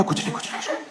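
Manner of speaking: quick under-the-breath retreat; whispered backpedal. Retold or imitated. Son dodging mom after a cheeky line, whispered. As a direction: mutter it so mom can't hear.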